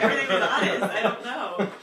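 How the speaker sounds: high, loud, laughing self-defense; laughing protest. Retold or imitated. Defending her taxes to her sister, in a laughing, flustered protest. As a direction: insist loudly through laughter.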